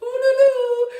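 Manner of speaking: high ululating cry; ululation. Real, not acted. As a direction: let out a festive ululation. Ululating in celebration. (youyou aigu de fête)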